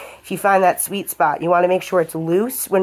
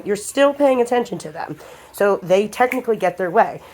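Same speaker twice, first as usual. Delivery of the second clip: usual speaking voice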